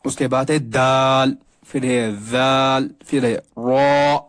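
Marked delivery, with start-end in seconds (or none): none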